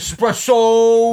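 very slow, loud Philly "Espresso"; Philly accent. Retold or imitated. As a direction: draw out "Espresso" with Philly swagger. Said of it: "my Philly on impression".

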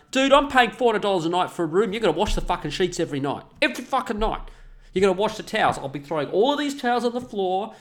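high indignant rant. Real, not acted. Ranting about hotels not washing sheets, indignant. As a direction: vent the outrage, pitch up.

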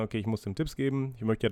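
usual speaking voice

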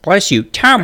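normal talking voice

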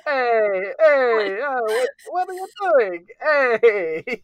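high, loud Italian American protest; hammed-up Italian-American street yell; Italian American shouting. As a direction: keep hollering like a cartoon Italian American. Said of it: "Just keep shouting".

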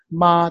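"ma" high, flat and singing; high level "ma"; first tone. Shown as a demonstration. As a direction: sing "ma" level at the top of the voice. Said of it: "high and flat and singing".

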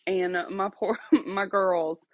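ordinary talk

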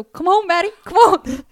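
high, loud sing-song call with a laugh; sing-song dog call. Retold or imitated. Sister imitating her sibling calling the dog inside, high sing-song. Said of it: "She would put on this voice to call Maddie inside".

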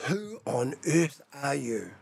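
slow, softened, weighted question; hushed questioning. Acted. Preacher rephrasing Pilate's question to Jesus, slow and hushed. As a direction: let each word land slowly and quietly.

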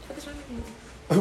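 lightly voiced exasperated parent, slow and soft; understated parental reprimand.

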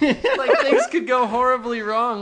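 high, loud excited gush; giddy loud excitement over a crash; mock thrilled. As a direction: ham up the thrill of disaster.

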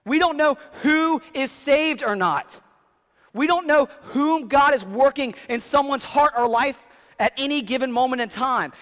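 loud, high, driving pulpit voice; preaching at full force. Acted. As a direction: preach it out to the whole room. Opening point of the sermon.

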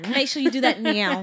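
loud bossy plug to viewers; hammed-up sales command; mock ad push. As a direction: order them to buy now. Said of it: "plugging the shop link".

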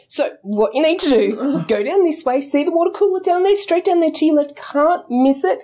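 high, chirpy guide voice; helpful direction-giver. Acted. Colleague sending the newcomer down the hall, helpful chirp. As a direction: lay out the route brightly and unhurriedly.

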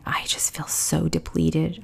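slow, soft, drained whisper; barely a breath, worn out; exhausted whisper. Retold or imitated. Answering her mother-in-law, in a slow, drained whisper.

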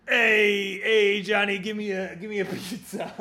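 put-on high, loud New York accent, a man doing a girl's voice; man doing a woman's voice, New York accent; New York girl impression. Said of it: "my impression of, of a girl from New York ordering pizza".

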